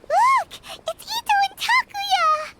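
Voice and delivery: high, delighted calling out; excited discovery. Acted. Paimon spotting the missing friends, excited cry. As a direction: pitch jumps up on spotting them.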